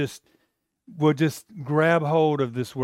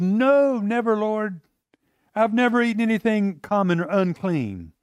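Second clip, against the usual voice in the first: high, pleading protest; Peter refusing; 5 semitones higher than usual. Acted. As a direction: push back with raised voice. Peter refusing in retold vision.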